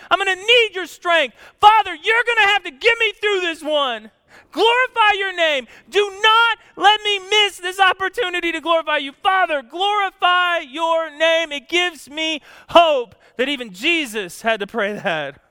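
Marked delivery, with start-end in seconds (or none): 0.1-11.5 loud, high, pleading prayer to the Father; impassioned cry. Acted. Preacher crying out as Jesus before the cross.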